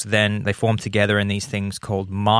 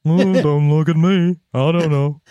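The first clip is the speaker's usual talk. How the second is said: higher-pitched cartoon giant, drawn into a moan; hammed-up giant voice, pitched up; Simpsons giant voice; 6 semitones higher, 4 dB louder than usual. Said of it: "He would talk like that".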